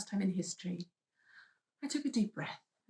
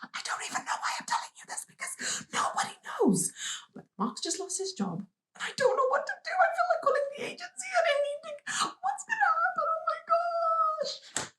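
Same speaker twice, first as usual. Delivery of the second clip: panicked phone call; 3 dB louder than usual. Retold or imitated. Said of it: "I call one of my best friends and I'm like".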